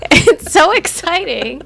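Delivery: laughing loud and high. Real, not acted. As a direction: giggle through the words. Laughing over nuts.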